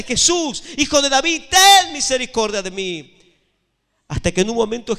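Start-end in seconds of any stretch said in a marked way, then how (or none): 0.0-3.1 high, forceful cry for mercy; louder desperate shout. Retold or imitated. Blind beggar shouts after passing Jesus, louder desperate shout. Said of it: "él gritaba más fuerte".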